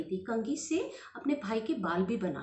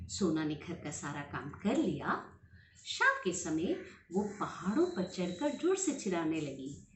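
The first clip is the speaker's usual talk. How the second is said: usual speaking voice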